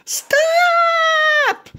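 high little pig shout; Piggy yelling at the spinning Gerald, high shout. Acted. Piggy yells stop.

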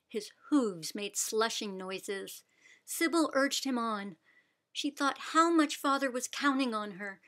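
ordinary delivery

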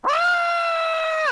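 high panicked scream. Real, not acted. Parachuting, about to be shot.

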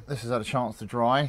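ordinary talk, nothing marked

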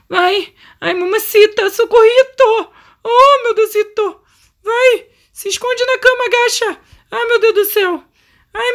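high, squealing cries of urgency; frantic panic. Real, not acted. Player rushing Renata under the bed, frantic.

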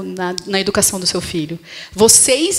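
ordinary talk

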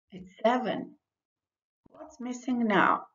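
ordinary talk, nothing marked

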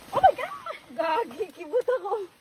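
high, loud startled yelp; startled shout. Real, not acted. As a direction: jump and shriek in surprise. Startled by cousins arriving.

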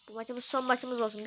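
ordinary talk, nothing marked

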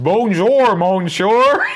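a proud, overdone French hello; mock French accent. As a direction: show off your French accent. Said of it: "nuanced and perfected French".

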